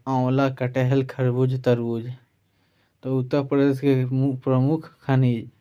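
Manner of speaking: normal talking voice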